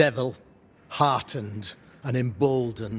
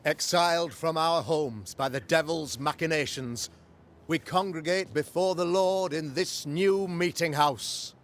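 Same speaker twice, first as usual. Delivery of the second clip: raised, ringing sermon voice to the flock; preaching; 5 semitones higher than usual. Acted. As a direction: proclaim it from the pulpit.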